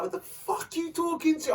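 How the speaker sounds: high-pitched retold angry challenge; mad outburst. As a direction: act out losing it on them. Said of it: "I went mad on them".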